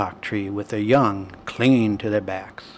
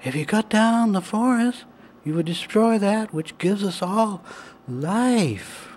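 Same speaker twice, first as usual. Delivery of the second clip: higher little animal voice; high porcupine voice; 9 semitones higher than usual. A narrator's reading. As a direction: speak it high like a small creature. Porcupines continue.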